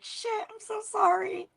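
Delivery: high, small squeaky mock apology; retold sheepish apology.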